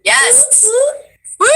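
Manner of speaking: ordinary delivery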